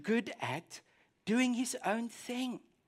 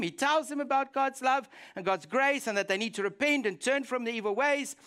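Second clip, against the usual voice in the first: ordinary delivery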